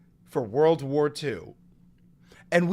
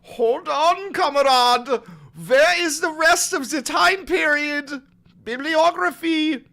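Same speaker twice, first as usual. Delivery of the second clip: high, loud accusing demand; mock interrogation; 10 semitones higher, 7 dB louder than usual. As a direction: grill him like a suspect. Mock interrogating Heinz, calling him comrade.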